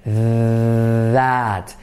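"that" said with a voiced th; key sound "that". Shown as a demonstration. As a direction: stress the th of "that". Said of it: "No es clave, pero es importante".

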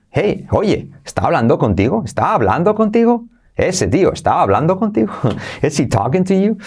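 "Hey" high, then "is he talking to you" rising; sing-song rising question; hey cue. Shown as a demonstration. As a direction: pitch up on "Hey", carry it into the question. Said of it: "nos da el punto alto".

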